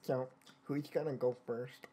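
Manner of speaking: low, quiet put-on nerd voice; geeky, unsexy voice. Shown as a demonstration. Redoing the nurse's question, geeky unsexy voice. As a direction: do a deliberately unsexy geek. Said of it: "let me do it in a geeky".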